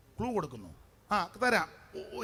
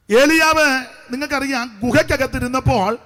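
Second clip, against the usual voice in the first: emphatic, rhythmic pulpit delivery; preaching; 4 semitones higher, 12 dB louder than usual. Real, not acted. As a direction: preach it out to the congregation, phrase by phrase. (ഊന്നിപ്പറയുന്ന സുവിശേഷ പ്രസംഗം)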